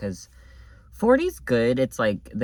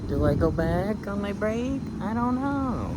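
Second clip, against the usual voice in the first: mock indecisive self; 9 semitones higher than usual. Quoting her own indecisive thought.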